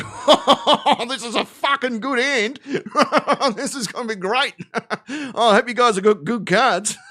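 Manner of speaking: giggling through a poker bluff; can't keep straight face. Acted. Aussie Bond at the poker table, laughing and giving it away. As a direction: gleefully give the hand away, laughing. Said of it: "He couldn't keep a straight face".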